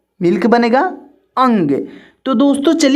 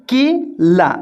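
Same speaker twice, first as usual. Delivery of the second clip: slow sounded-out word; much slower than usual. Shown as a demonstration. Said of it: "केला बोलने पर".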